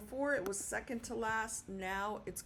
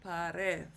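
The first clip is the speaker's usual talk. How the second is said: pared said pa-RED in Spanish; stress on last syllable; 2 semitones lower than usual. Shown as a demonstration. Teacher repeating the pronounced word, pared stressed on RED. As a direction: punch the second syllable of pared. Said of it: "so it's pronounced".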